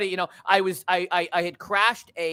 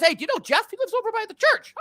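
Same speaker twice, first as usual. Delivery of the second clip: very high, excited imitation; hammed-up high-pitched greeting; eager introduction; 4 dB louder than usual. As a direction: pipe up like an over-eager local introducing a newcomer. Acts out other guy's introduction.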